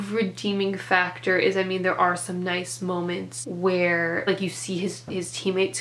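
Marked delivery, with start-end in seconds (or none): none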